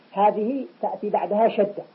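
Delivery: ordinary delivery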